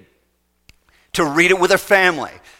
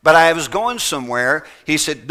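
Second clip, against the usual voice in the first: ordinary talk, nothing marked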